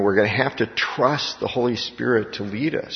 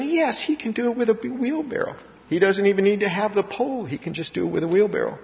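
mock cheerleader; 9 semitones higher, 4 dB quieter than usual. Mock eager believer cheering.

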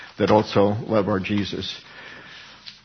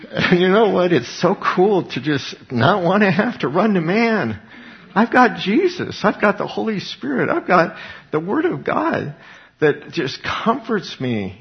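joyful high exclamation; 7 semitones higher than usual. Real, not acted. Preacher rejoicing over Jesus.

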